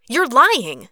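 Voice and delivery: loud, high burst of accusation; angry accusation. A narrator's reading. Katori refusing to believe Cruz's denial, angry and loud. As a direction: throw the accusation at him loudly.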